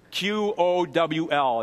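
repeated spelling for note-takers; slow spelling. Shown as a demonstration. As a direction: spell "Q O W L" very slowly, letter by letter. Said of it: "it's spelled Q O W L".

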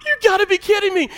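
very high, loud, fast outburst; shrieking disbelief. Acted. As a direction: explode as the lottery player. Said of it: "and you're like".

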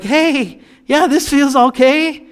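high, forced-casual voice, covering fear; mock nervous cheer. Scared person faking calm to himself, high nervous brightness. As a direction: pretend everything is fine while scared. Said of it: "that tone in his voice".

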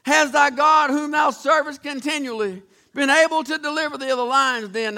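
loud anguished call from the king; crying out. Acted. King calling into the lion's den, loud and anguished. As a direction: shout it out, desperate. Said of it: "And he cries out".

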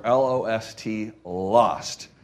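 L O S T letter by letter, slowly, then lost; spelled out for emphasis. Shown as a demonstration. Preacher stressing life before Jesus, lost spelled out emphatically.

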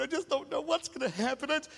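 whiny worrier voice. Pretend. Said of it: "I'm stuck in this voice".

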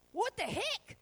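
high startled cry; mild shock at the door; startled servant. Retold or imitated. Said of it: "steps outside the door and goes".

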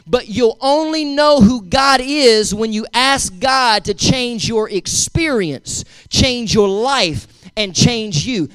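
slow, deliberate, each phrase spaced out; said slowly. Shown as a demonstration. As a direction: drag it out so they can write it down. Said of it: "I'll try to say it as slow as it came to me".

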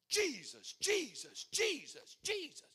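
hammed-up climbing chant of Jesus; mocking repetitive prayer. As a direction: parody a frantic repeated prayer. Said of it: "people that pray that way".

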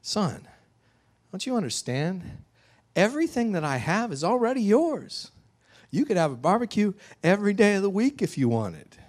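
high, earnest appeal without shouting; pleading father. Acted. Father reassuring his older son, pleading. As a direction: reason with a hurt son. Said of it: "the father looks at him, he says".

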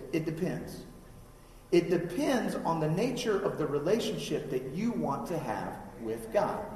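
ordinary talk

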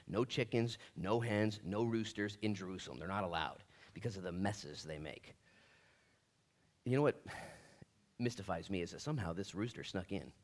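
normal talking voice

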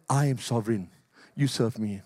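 low, solemn voice of God. Acted. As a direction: let God speak low and grave. Preacher voicing God's declaration.

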